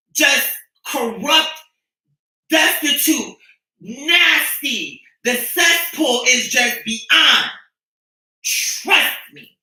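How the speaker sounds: worked-up shouting, dragging every word. Real, not acted. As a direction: spit each word out slowly at full volume. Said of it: "I'm getting too worked up".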